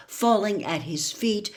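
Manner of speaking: plain reading voice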